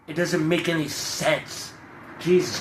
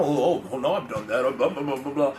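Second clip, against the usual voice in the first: mocking dismissive excuses; 3 semitones lower, 3 dB quieter than usual.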